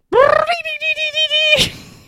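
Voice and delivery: high shrill squealing trill; a squeaky cartoon sound effect. Shown as a demonstration. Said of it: "add a sound effect that goes".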